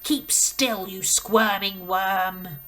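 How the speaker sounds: loud, slow scolding; stern headmistress. Acted. Headmistress orders the dangling boy to keep still, loud. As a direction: snap at the wriggling boy.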